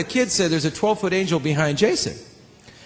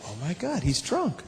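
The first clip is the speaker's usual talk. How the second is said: much softer shocked aside; hushed thought; 9 dB quieter than usual. Retold or imitated. Seeing Jason teetering on the piano bench, hushed. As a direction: say it under your breath. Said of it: "I thought".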